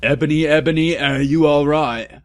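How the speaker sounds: worried. A narrator's reading. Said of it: "in a worried voice".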